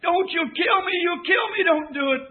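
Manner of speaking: high, loud desperate pleading; injured boy begging the crowd not to kill him; pinned boy pleading. Retold or imitated. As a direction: cry out in pain under the car. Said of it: "He said".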